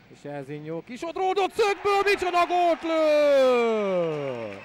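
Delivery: loud, very slow goal call; excited Hungarian sports commentary. Acted. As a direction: draw out the goal roar.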